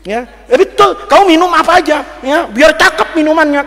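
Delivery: loud, high preaching to the crowd; preacher booming at the congregation; fiery preaching. Acted. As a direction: preach it hard. Preacher at full force.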